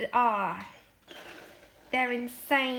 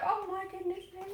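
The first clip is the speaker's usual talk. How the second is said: soft swoon; 5 semitones higher, 8 dB quieter, faster than usual. Retold or imitated. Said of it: "down here and go".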